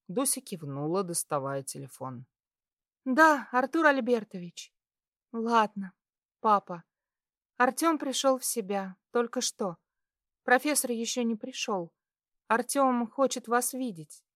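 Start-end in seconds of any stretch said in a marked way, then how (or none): 3.1-14.2 high, light female phone voice; dutiful young woman. A narrator's reading. Daughter-in-law phoning to report patient awake, high and dutiful. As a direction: report politely in a higher voice.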